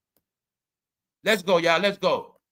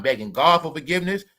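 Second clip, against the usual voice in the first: ordinary talk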